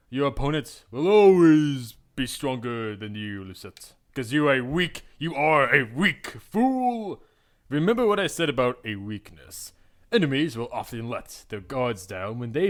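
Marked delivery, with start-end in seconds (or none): 0.1-7.2 taunting mentor. Acted. Claude taunting Lucette as a weak fool, louder. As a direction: sneer the insult down at her.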